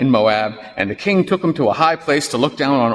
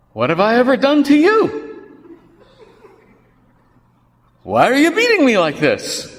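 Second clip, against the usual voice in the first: high, louder pained donkey voice; talking donkey; 13 semitones higher, 4 dB louder than usual. Acted. The beaten donkey pleading with Balaam, high and hurt. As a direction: cry out like a hurt animal asking why.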